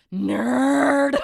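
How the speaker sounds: high, loud jeering Nerd; mocking taunt. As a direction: shout it like a playground taunt. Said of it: "every single time I just go".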